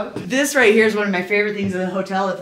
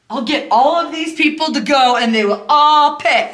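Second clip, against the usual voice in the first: put-on pushy drunk gal; 6 semitones higher, 6 dB louder than usual. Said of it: "she's like".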